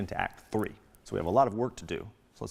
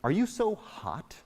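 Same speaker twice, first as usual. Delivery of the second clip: teasing; 10 semitones higher, slower than usual. Acted. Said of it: "the nurse says to her".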